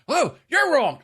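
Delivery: dad insists on three, heated; heated retort. Retold or imitated. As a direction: snap back at the son. Dad's side of quarrel.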